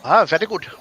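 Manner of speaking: imitating an Indian accent; light Indian accent impression. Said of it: "talking in an Indian accent".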